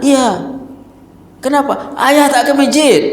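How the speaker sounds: put-on high, slow, reproachful little boy; child's voice. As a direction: let the child accuse his father gently. Child answers father.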